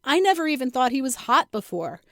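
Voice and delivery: ordinary delivery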